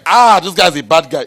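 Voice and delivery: loud, scornful aside as the investor; mock scornful investor. Speaker mimics investor judging a needy founder, mock scorn. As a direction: sneer it as the unimpressed investor.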